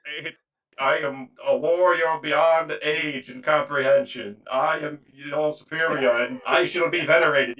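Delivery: loud, slow, grandiose proclamation; pompous dreadnought warrior. Acted. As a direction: boom it out like an offended ancient war machine. Plays the evicted dreadnought warrior.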